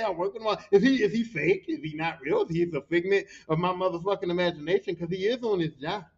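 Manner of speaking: usual speaking voice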